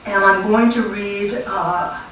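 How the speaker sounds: usual speaking voice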